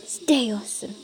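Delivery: normal talking voice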